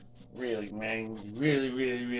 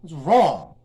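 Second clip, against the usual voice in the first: worked up; 7 dB louder, slower than usual. Real, not acted. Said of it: "I'm getting all worked up".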